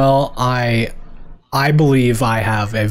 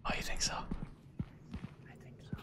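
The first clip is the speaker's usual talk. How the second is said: hushed spooky whisper; 9 dB quieter than usual. Pretend. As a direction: whisper it like a horror film. Horror game mood, hushed.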